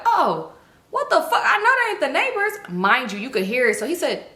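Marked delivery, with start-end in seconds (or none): 0.0-2.5 high mock-indignant exclamation; outraged uncle. Uncle hears the noises, imitated high-pitched outrage. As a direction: exclaim it high, half laughing.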